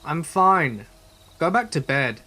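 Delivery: yelling out. Retold or imitated. Todd answering the voice in the woods, yelling. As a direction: yell it to someone far off. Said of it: "yelling out into the night".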